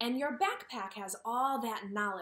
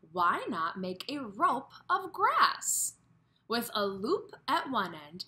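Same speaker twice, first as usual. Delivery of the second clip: lively storybook reading, voices for the animals; reading to children; 6 dB louder than usual. Acted. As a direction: read it like a picture book for kids. Reads the story aloud.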